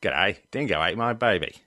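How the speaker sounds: hammed-up, imperfect Australian twang; Australian accent. Shown as a demonstration. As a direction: have a hearty go at an Aussie accent. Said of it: "I'm not that great with an Australian accent".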